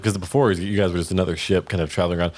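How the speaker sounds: ordinary delivery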